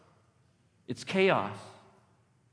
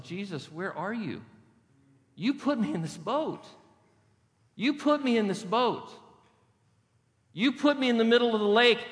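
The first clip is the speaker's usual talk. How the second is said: pleading complaint; 6 semitones higher than usual. Acted. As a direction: build from lost plea to accusation. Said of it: "us in that boat are just saying".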